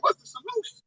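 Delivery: high, soft, small mock voice; mock worried heathen. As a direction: ape a nervous outsider begging for answers. Mocking a heathen asking him.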